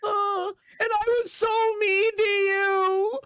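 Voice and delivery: high, wailing sobbed apology; overdone weepy breakdown; crying. Acted. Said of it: "breaks down a little bit emotionally".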